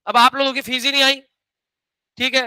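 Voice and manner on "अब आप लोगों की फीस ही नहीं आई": ordinary delivery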